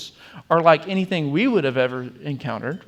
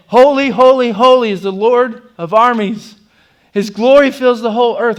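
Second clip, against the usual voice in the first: much higher and far louder, ringing out; full-voiced angelic cry of praise; powerful exclaiming voice; 7 semitones higher, 13 dB louder than usual. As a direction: proclaim it like an angel calling across the throne room. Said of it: "it is a powerful voice that is exclaiming".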